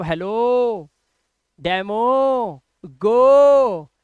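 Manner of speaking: stretched final O on हेलो, डेमो, गो; final O as 'oh'. Shown as a demonstration. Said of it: "ओ को ओ पढ़ना सीखें".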